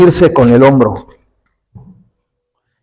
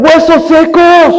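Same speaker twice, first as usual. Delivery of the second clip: high, drawn-out shouted call; prophet's cry; 13 semitones higher, 4 dB louder, slower than usual. Acted. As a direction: call out slowly across a huge graveyard. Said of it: "Y empieza a gritar". (grito agudo y alargado de profeta)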